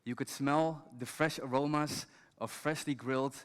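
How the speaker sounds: ordinary talk, nothing marked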